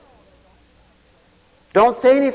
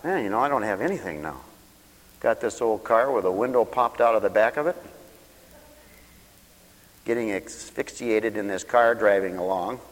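muttered self-talk; 8 semitones lower, 5 dB quieter than usual. Retold or imitated. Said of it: "I thought".

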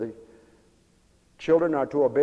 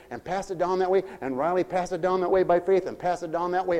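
ordinary delivery

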